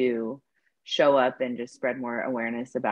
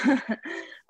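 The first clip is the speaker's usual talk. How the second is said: high giggle; 12 semitones higher than usual. Real, not acted. Laughing at own story.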